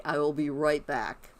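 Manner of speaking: normal talking voice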